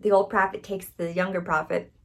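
usual speaking voice

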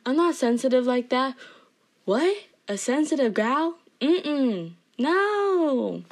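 mock denial. Jokingly denies being sensitive.

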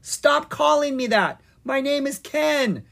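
very high, loud exasperated protest. A narrator's reading. Wizard rejecting the crowd's name for him, protesting. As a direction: protest at the top of your voice. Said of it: "Ken protested".

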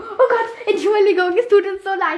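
high, loud mock-horrified apology; flustered apology. Strangers apologizing to the kids for the falling umbrella, flustered.

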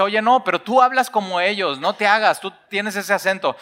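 don't let him off the hook; insistent accuser. Acted.

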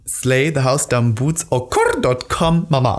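slow, drawn-out emphatic catchphrase; catchphrase delivery. Shown as a demonstration. Giving co-host an example of loved phrases, slow catchphrase. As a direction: relish each word, stretching it. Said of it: "wenn ich zum Beispiel sage".

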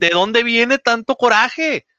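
exasperated, drawn-out question; exasperated emphasis. Real, not acted. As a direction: lean on coraje with a rising, drawn-out question. Exasperated question about anger.

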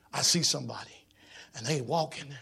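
usual speaking voice

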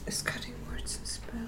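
whispering. Real, not acted. Quiet question mid-conversation.

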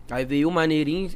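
ordinary delivery

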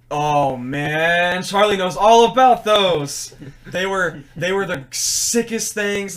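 loud, excited and slow. Real, not acted. Telling friends about her favorite fried snack, excited.

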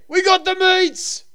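high, slow shouted catchphrase; yelled slogan. Acted. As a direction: greet callers by shouting it. Phone bit.

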